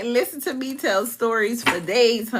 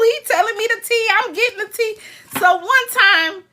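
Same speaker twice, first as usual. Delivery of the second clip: usual speaking voice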